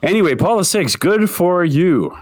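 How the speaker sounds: grand pompous tribute; mock solemn. Mock solemn praise of pope.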